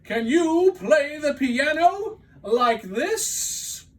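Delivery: high, loud call; boastful cry. Acted. Toad boasting to Frog from the stage, loud call. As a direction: show off to your friend. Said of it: "cried Toad".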